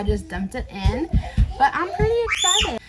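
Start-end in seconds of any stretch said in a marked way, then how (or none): 1.6-2.8 excited. Real, not acted. Excited about pickles turning out.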